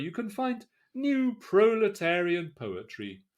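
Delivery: ordinary talk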